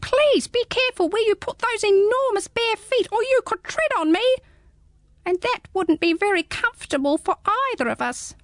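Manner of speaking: high, small squeaky creature; a tiny curious hedgehog peering up; high little hedgehog, puzzled. Acted. Hedgehog speaking.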